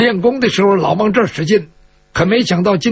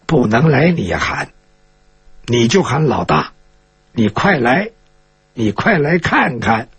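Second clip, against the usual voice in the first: low-pitched, controlled order; low command; 7 semitones lower than usual. Acted. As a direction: dictate the words to your captive. Woman dictates what captive must shout. (压低嗓音下命令)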